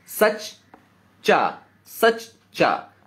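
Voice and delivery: सच चा said slowly, broken into two beats; split syllables. Shown as a demonstration. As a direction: break सच्चा into सच and चा. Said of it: "आधा चा हम सा के साथ मिलाकर बोलते हैं". (सच चा को धीरे तोड़कर)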